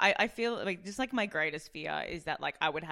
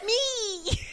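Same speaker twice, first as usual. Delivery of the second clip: excited squeal; 7 dB louder than usual. Retold or imitated. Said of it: "felt my heart go".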